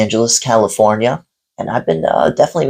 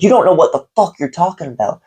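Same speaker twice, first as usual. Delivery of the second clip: higher, mock furious rant at a customer; retold angry rant; 8 semitones higher than usual. Owner ranting at a customer, imitated high furious rant. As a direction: rant like he's losing it, pitched up. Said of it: "he was just going off and off".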